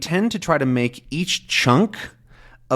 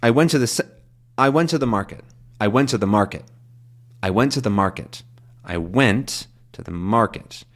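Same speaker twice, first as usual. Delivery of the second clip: "went" stressed in "I went to the market", repeated; rolling sentence-stress demo; faster than usual. Shown as a demonstration. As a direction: lean on "went" each time, listening to the rhythm. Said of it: "let's just listen to it".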